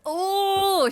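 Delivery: ordinary talk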